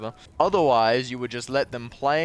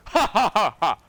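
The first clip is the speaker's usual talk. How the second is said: mock laugh; 9 semitones higher, 6 dB louder than usual. Mocking laugh at reply.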